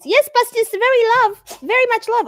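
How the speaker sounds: lavish love on the dog in a high voice; gushing pet talk. Real, not acted.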